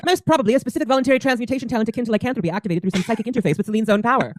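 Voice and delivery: rapid-fire technical analysis; Tessa. Acted. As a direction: rattle it off like an analyst. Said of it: "And she goes".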